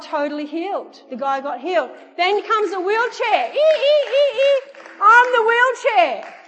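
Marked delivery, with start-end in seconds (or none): 3.5-4.6 very high, loud imitated squeaks; wheelchair squeak. Preacher imitating a wheelchair coming down the aisle, squeaking. 5.0-6.3 high, slow, loud comic announcement; hammed-up shout. Wheelchair user answering the call, hammed-up shout. Wheelchair person arriving, acted.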